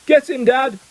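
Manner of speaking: high, urgent cheering-on yell. A narrator's reading. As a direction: yell it out high, egging him on. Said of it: "There was a yell of".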